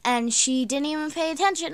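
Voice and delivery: usual speaking voice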